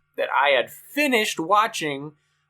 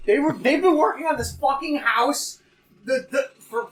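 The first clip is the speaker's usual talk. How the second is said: exasperated high-pitched venting; angry rant; 12 semitones higher than usual. Real, not acted. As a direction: rant at the construction. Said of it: "I'm so angry".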